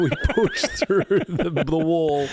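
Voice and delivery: high, slow, laughing mock triumph; mock-heroic strain. Host keeps boasting through laughter, in mock-heroic drawl.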